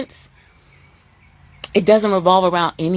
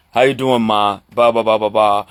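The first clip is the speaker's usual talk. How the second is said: deep booming son's greeting; a hint of a low booming son; 9 semitones lower than usual. Voicing booming-voiced son.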